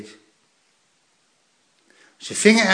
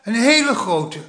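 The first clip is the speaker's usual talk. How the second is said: put-on excited boy; 7 semitones higher than usual. A narrator's reading. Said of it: "zei Plop".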